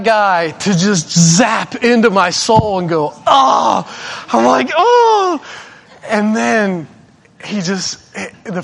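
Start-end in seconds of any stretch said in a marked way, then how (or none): 3.3-3.8 loud mock groan; raised, hammed-up "oh"; pained groan. Imitates being zapped in the soul. 4.7-5.4 high, drawn-out mock wail; hammed-up high cry; stung cry. Acts out his stung reaction.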